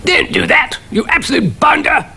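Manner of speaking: high, blustering officer roar. A narrator's reading. Cannon being dusted, roaring in protest. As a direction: bark at the bounder. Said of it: "roared the cannon".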